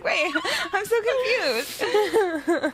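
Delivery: high, slow, giggly bewilderment; laughing confusion. Retold or imitated. Host laughs at missing the joke, high giggly confusion. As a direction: drag it out helplessly through laughter.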